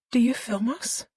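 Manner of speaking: a hint of a scared teenage girl; Callie's voice. A narrator's reading. Callie asks, higher voice.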